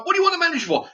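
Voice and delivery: very high-pitched imitated question; mock flustered worker. Worker asking the screaming customer why, in mock high voice. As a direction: squeak the question back, mocking him.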